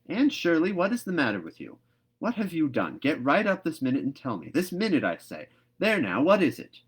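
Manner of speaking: a loud, high, hurried scolding; scolding command. A narrator's reading. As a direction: get her up this minute. Marilla ordering Anne up.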